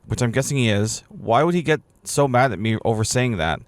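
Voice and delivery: usual speaking voice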